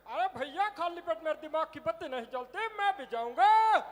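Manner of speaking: high chubby cartoon voice; Motu cartoon voice. Comic showing the audience how Motu begs to go, high cartoon voice. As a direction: do Motu whining about his empty stomach. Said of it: "वो कहता".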